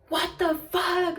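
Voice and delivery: high, slow, drawn-out indignant disbelief; mock outrage. Girl discovering the drink on her shoes, imitated outrage.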